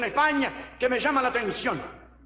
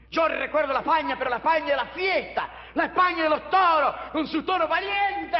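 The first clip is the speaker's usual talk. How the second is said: hammed-up ode to the bullfight; impassioned oratory; 6 semitones higher than usual. Evocación exaltada de la fiesta. (evocación exaltada, cada vez más aguda)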